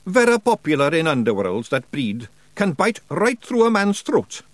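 high, broad Scots wee man, cheeky and gruff; Scottish pictsie Rob. Acted. Pictsie chief thanking nobody for thanks, in a high Scots voice.

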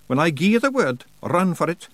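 usual speaking voice

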